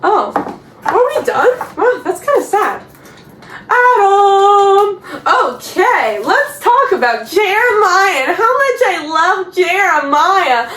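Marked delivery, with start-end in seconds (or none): 3.7-10.7 drawn-out, higher sing-song sarcasm; mock adoration. Vlogger introducing Jeremiah, whom she hates, sarcastic gushing. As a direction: gush with obviously fake love. Sarcastic love for hated character.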